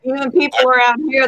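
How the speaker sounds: ordinary delivery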